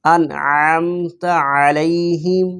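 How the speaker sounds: انعمت علیہم slow, the ayn of علیہم voiced from the throat; slow tajweed chant with a throaty ayn; clear ayn. Shown as a demonstration. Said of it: "عین کو نکالنا ہے".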